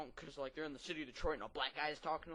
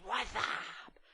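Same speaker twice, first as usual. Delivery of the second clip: higher, mild mock yell; imitated phone scream; 6 semitones higher than usual. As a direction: imitate a guy yelling into the phone. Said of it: "the guy screams in the phone".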